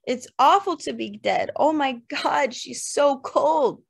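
a grieving son crying out into the dirt; anguished cry. Acted. Son screaming up at his father in grief. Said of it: "He screamed up through dirt".

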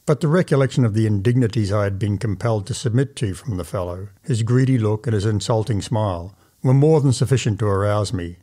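plain reading voice

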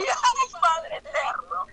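startled cry. Retold or imitated. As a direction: cry out in shock.

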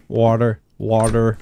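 "water" with a careful final R; R's pronounced. Shown as a demonstration. Bostonian trying to say R's, "water" ending in a clear R. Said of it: "I'm trying to say my R's".

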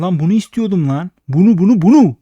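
excited shout. Real, not acted. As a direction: yell it as the big win lands. Slot finally pays out.

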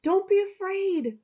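shepherd calling out to his lamb. Acted. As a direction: call out to reassure the lost lamb. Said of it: "the shepherd called out".

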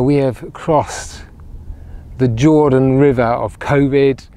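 ordinary talk

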